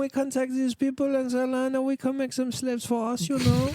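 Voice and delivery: very high, rapid French caricature; hammed-up French colonizer; mock French. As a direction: rattle it off in a high, put-on French accent. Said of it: "the Brits or the French".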